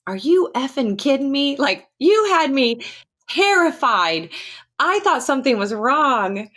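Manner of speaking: retold outburst, high-pitched and indignant; imitated exasperated friend, voice pushed up; exasperated cussing. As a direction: scold a friend who gave you a scare. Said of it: "with some strong cuss words".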